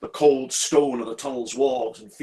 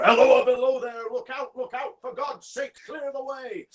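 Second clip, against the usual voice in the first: very high, strained cry, fading in volume; relentless distant shouting; 14 semitones higher than usual. Acted. Figure keeps warning the signalman, shouting high and urgently. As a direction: keep crying out the warning as if from afar. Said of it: "he must be hoarse with shouting".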